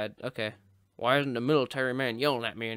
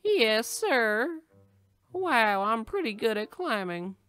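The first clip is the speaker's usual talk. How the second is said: high, silly soldier voice; obedient recruit; 9 semitones higher, 3 dB louder, slower than usual. Pretend. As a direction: answer the sergeant in a high put-on voice. Playing soldier answering the yelling military man.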